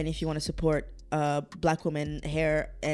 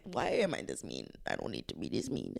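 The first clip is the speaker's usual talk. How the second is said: lower, quieter, pretending to scold herself; mock deep scolding voice; 12 semitones lower, 8 dB quieter than usual. Vlogger scolding herself in a put-on deep voice. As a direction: drop low and softer to scold yourself.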